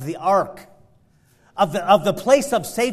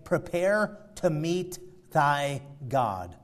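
softer and slow, grave; hushed solemn repeat; 5 semitones lower, 9 dB quieter, slower than usual. Acted. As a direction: let the warning fall quiet and slow. Same verse repeated, hushed.

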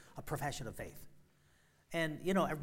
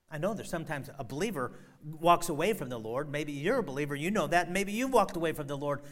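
much louder, higher, urgent; full-force preaching; 3 semitones higher, 9 dB louder than usual. Real, not acted. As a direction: thunder it to the congregation.

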